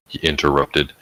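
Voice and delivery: ordinary delivery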